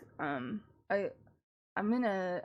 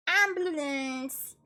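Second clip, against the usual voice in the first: drawn-out coo; 5 semitones higher, 4 dB louder, much slower than usual. Real, not acted. Cooing at her dog.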